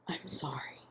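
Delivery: soft, strangled whisper; choked whisper. A narrator's reading. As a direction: barely get it out, choked. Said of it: "Ian repeated in a choked whisper".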